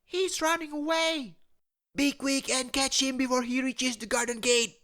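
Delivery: very high put-on voice calling urgently; old man crying out. A narrator's reading. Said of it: "cried the old man".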